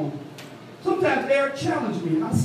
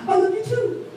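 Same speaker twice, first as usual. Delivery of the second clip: imitating mother-in-law; 11 semitones higher than usual.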